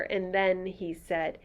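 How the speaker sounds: plain reading voice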